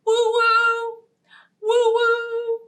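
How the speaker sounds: high hooting train whistle woo-woo; playful whistle call. Pretend. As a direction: hoot like a toy train.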